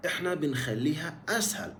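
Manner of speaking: ordinary talk, nothing marked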